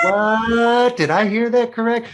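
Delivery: high, loud stunned what; reliving the jolt of the news; shocked disbelief. Retold or imitated. Shocked reaction retold to host.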